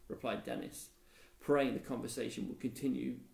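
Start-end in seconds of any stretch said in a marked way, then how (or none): none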